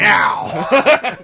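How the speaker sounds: loud high playful meow; cat meow. Pretend. Cat impression.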